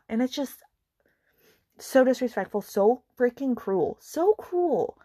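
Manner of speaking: high, loud, drawn-out condemnation; exasperated, pitch leaping up on the last phrase; appalled indignation. Real, not acted. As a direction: hammer each "so" in outrage. Condemning the husband's cruelty.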